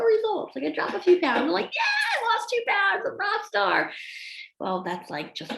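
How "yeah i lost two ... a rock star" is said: high-pitched celebration; exaggerated excited cheer; mock triumphant boast. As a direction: crow like a rock star. Acting out delight at small weight loss.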